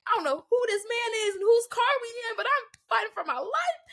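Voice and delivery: high, tearful panicky voice; trapped in a smoky car, panicking; freaking out. Retold or imitated. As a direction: cry it out in mock panic. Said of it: "I'm freaking out".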